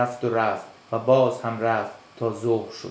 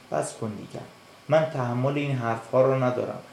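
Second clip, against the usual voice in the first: ordinary delivery, nothing marked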